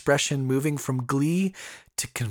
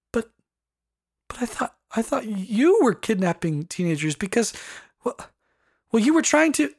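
1 flustered stammer; 12 semitones higher, 4 dB louder than usual. A narrator's reading. Pearl confronts Brom, stammering.

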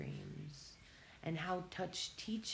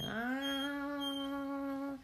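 contained mock scream on one sustained high note; imitated yell; 13 semitones higher than usual. Voicing an overwhelmed nervous system, mock yell. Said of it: "The nervous system is yelling".

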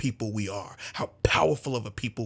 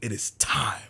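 hushed, breathy whisper. Real, not acted. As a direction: drop to a whisper for weight. Solemn call to action.

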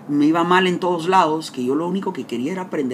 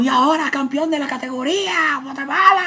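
much higher, loud excited announcer call; mock announcer; 12 semitones higher, 5 dB louder than usual. Imitating the announcer crowning him champion. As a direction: hype it like a ring announcer. Mimics the announcer at his win.

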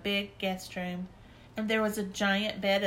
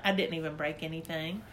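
plain reading voice

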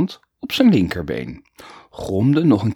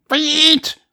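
very high, loud whistle-like cry; shrill it like a whistle blast; whistling call; 6 dB louder than usual. Acted. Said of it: "floot hij, harder dan gewoonlijk". (fluitend geroepen)

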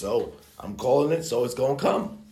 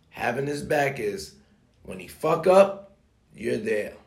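ordinary talk

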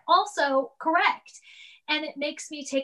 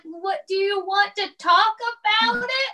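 careful husband; 8 semitones higher, 3 dB louder than usual. Retold or imitated. Husband gently patting her shoulder.

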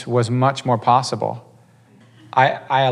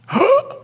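sharp, high acted gasp; scandalized intake of breath; shocked gasp. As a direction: suck in breath in mock shock. Imitating the shocked wife's reaction.

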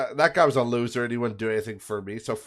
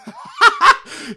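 high, loud burst of laughter; laughing; 11 dB louder than usual. Real, not acted. Vlogger cracks up before revealing the phone bill, laughing. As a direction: crack up helplessly mid-story. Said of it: "I'm just laughing 'cause it's so funny".

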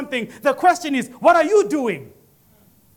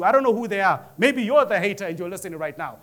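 usual speaking voice

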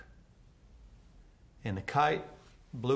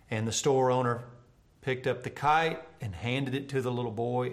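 normal talking voice

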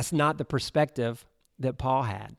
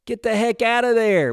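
louder, higher disbelieving plea; exasperated urging; 8 semitones higher, 5 dB louder than usual. Retold or imitated. Preacher urging Paul to flee, louder and higher. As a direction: push Paul to get out now. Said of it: "Wha-, like".

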